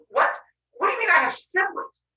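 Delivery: high-pitched incredulous question; acted shock. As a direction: ask it in high mock disbelief. Zeus acted shock at siblings.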